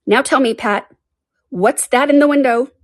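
a hint of high, bossy Rabbit; questioning Rabbit. A narrator's reading. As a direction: question the servant from above. Rabbit questions his servant.

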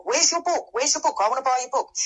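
high-pitched mock clamour; excited retold demand; eager clamouring. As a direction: imitate an insistent fan, pitched well up. Imitating eager audience demanding her book.

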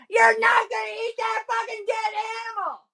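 very high-pitched screamed outburst. Acted. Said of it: "Sonny screams".

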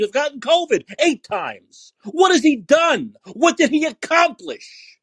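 real furious rant at the listener; yelling in outrage at Fauci's record; angry yelling. Real, not acted. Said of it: "I'm sorry to yell at you".

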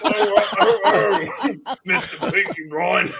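high, loud, laughing mock gangster voice; mock London gangster growl, breaking into laughter; Alfie Solomons impression. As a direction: ham up an old man doing Bane, cracking up.

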